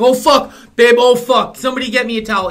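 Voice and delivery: loud, high fake alarm; mock panic. Dad faking an injury to his wife, hammed-up loud panic. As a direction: ham up a bleeding hand.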